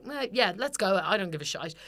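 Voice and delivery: ordinary talk, nothing marked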